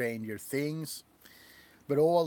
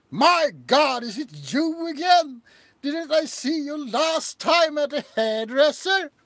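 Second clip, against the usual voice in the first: much higher, loud snooty shouting; uptight British waiter; 12 semitones higher, 7 dB louder than usual. Pretend. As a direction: sneer at the customer in a prissy high voice. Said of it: "very uptight British hairdresser".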